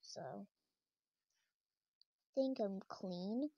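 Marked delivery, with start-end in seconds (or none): none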